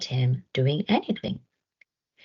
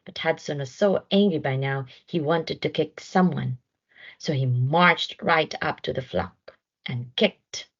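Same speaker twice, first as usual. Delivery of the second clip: ordinary delivery, nothing marked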